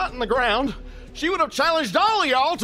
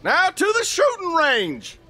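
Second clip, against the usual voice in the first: 1 loud announcement; 6 semitones higher, 5 dB louder than usual. Acted. Announces next contest loudly.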